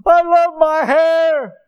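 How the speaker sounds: loud, high, drawn-out wail; mock crying boy. Preacher imitates crying boy losing his hair, mock wail. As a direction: wail slowly like a crying recruit. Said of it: "this boy was just crying".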